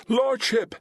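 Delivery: a sudden high alarmed bellow. A narrator's reading. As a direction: yell his title in alarm. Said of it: "someone bellowed".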